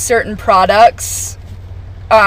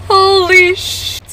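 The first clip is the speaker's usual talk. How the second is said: stunned; 9 semitones higher, slower than usual. Real, not acted. Disbelief at immediate termination.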